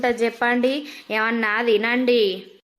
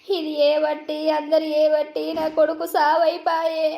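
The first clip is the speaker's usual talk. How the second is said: ordinary talk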